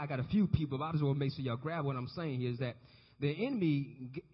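ordinary talk, nothing marked

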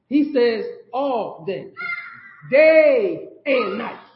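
all day, day and night, drawn out slow and swelling loud; stressed, drawn out. Acted. To the congregation, pressing the command, very slow and emphatic. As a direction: stretch each word and hit night hard.

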